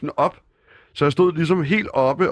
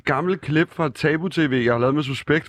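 ordinary delivery

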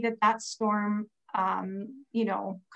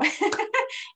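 embarrassed laugh; 12 semitones higher than usual. Real, not acted. Laughing about praying in bathtub.

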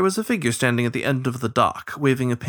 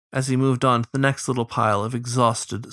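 plain reading voice

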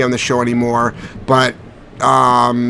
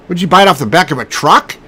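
usual speaking voice

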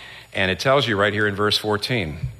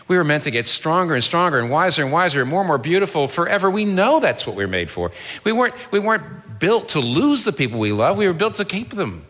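higher-pitched, urgent preacher; impassioned preaching; 9 semitones higher than usual. Real, not acted. Preacher on what we were made for, impassioned. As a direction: build in fervour, leaning on the key words.

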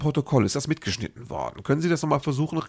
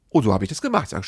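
normal talking voice

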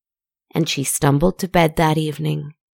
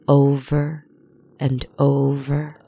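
usual speaking voice

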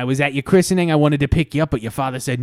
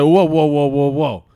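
mock alarm; 7 dB louder, slower than usual. Stag objecting to riding wife.

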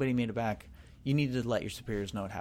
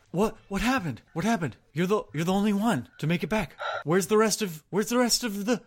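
high, loud panicked questions; frantic guard shouting at the lone survivor; alarmed soldier; 9 semitones higher, 6 dB louder than usual. Acted. A guard rushing up to the survivor, panicked and loud.

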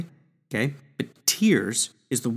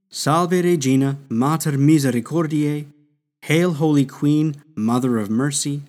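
prayer recitation. Acted. Teacher reciting the Salve Regina aloud to the class. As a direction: recite the Latin prayer with reverence.

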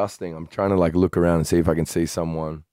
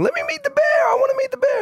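shrieking eager voice, far higher; excited friend; faster than usual. Retold or imitated. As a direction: squeal it like a thrilled tripper. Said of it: "Marissa's going".

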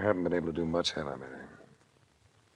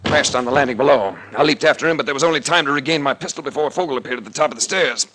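loud, urgent telling of the fall on the stairs; tense action narration; 6 semitones higher, 11 dB louder than usual. A narrator's reading. Detective narrating the scuffle on the stairs, loud and urgent. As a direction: push the action hard and fast, louder.

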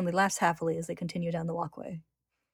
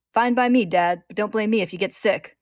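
loud, higher opening burst of bravado; defiant shout; 4 semitones higher, 10 dB louder than usual. A narrator's reading. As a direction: throw a cocky farewell at Father. Greed's defiant last shout.

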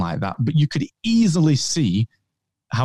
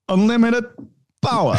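Walken as the Emperor; 9 semitones higher than usual. Retold or imitated. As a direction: shout it Walken style. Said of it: "Christopher Walken memes".

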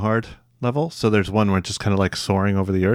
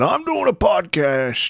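high, drawn-out proclamation; mock announcement; 11 semitones higher, much slower than usual. Parent imitating the child's podcast takeover, high drawn-out mock announcement. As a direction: imitate a kid declaring it, high and stretched out. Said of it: "you just tied me to the chair and said".

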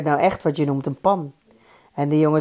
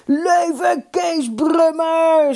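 high, long drawn-out cheer; slow, stretched jubilant cry; crowd shout; 11 semitones higher, 4 dB louder, much slower than usual. A narrator's reading. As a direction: cheer the hero from all sides. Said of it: "schreeuwden ze van alle kanten".

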